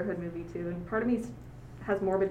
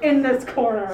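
ring announcer; 8 dB louder than usual. Pretend. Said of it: "pro wrestling sound effects".

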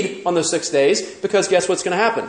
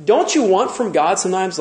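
normal talking voice

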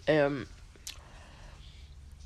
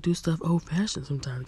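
ordinary talk

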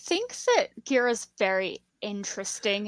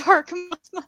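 laughing, raised pitch; 8 semitones higher, 5 dB louder than usual. Real, not acted. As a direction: say it through a laugh. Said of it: "I love that".

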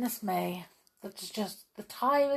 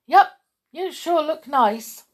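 higher, hesitant flattering; nervous little rabbit sucking up; nervous apology; 7 semitones higher, 6 dB louder than usual. Acted. Thumper apologises to the bear, higher nervous hesitation. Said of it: "Thumper tried again".

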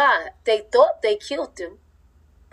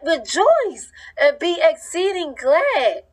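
high, slow joyful proclamation; joyful proclaiming; 6 semitones higher, slower than usual. Retold or imitated. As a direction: sing it out like a happy verse. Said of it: "no, you be like".

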